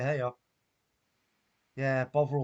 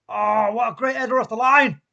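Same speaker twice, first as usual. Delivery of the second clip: excited shout; 6 semitones higher, 10 dB louder than usual. Real, not acted. Watchalong, sudden goal-line clearance.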